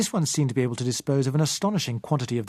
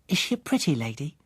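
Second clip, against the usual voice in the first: a hint of a child voice; lightly voiced little girl; 2 semitones higher than usual. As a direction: gently play the curious child. Child Susan voiced in story.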